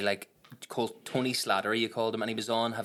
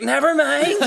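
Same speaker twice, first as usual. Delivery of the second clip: laughing mock shout; 13 dB louder, slower than usual. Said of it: "she would shout".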